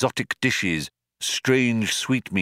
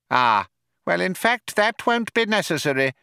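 mouse voice; 10 semitones higher than usual. Acted. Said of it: "said Frankie Mouse".

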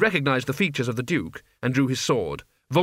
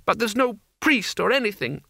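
high, tearful complaint; upset, pitched-up wail; nearly in tears; 8 semitones higher than usual. A narrator's reading. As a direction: plead it, close to crying. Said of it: "nearly in tears".

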